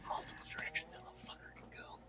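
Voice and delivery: hushed tense whisper. Real, not acted. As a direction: breathe it out under your breath. Tense moment, hiding from creature.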